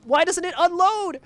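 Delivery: high, loud exasperated whine; frustrated player. Retold or imitated. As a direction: whine it like a stuck beginner. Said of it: "you're like".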